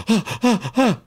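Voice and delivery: sharp high gasping breaths; cold water gasps. Retold or imitated. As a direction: gasp as if hit by icy water. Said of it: "all you can do is go".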